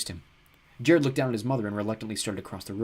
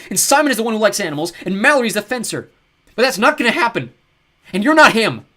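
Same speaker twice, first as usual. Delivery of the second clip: put-on high, loud, furious boy's rant; angry outburst; 12 semitones higher, 13 dB louder than usual. Jared rages at the ogre posing as his dad, shouting. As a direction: let it all pour out, much louder and higher. Jared yells at fake father.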